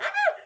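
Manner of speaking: sharp high shriek of disgust; retold scream. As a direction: shriek it, startled by the flopping fish. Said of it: "and I screamed".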